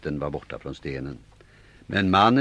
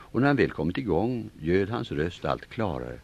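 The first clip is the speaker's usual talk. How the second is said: ordinary delivery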